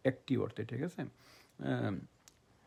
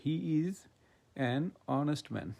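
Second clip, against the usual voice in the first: slow teacher read, word by word; careful classroom dictation, each word apart; slow example sentence; much slower than usual. Shown as a demonstration. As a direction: spell out "he is an honest man" slowly with a silent h in "honest". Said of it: "এইচটা কিন্তু silent থাকতেছে".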